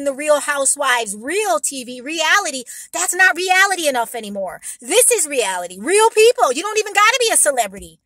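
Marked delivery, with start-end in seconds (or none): 5.8-8.0 loud, high and fast; emphatic loud. Real, not acted. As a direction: push it out with energy. Emphatic point.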